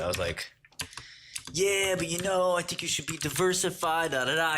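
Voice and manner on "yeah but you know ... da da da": mock timid advice. Retelling his advice to the stock picker, mock timid.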